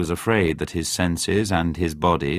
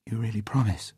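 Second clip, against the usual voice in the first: softer, hopeful through tears; tearful hope; 4 semitones higher, 5 dB quieter than usual. A narrator's reading. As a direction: ask it hoping, teary. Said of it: "looked at him gratefully through her tears".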